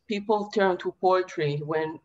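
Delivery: usual speaking voice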